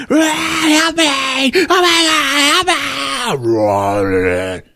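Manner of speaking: a beast mimicking a woman's terrified screams; monster faking screams. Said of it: "it's like".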